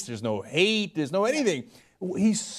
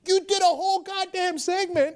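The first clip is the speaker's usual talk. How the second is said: outraged; 12 semitones higher than usual. Real, not acted. Outraged at O'Reilly's hypocrisy.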